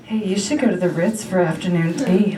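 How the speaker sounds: demon voice whispered into the ear, coaxing; tempting demon. Acted. Spindly demon tempting a broke shopper, coaxing voice. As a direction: coax like a little devil on the shoulder. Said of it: "a voice comes in my ear like".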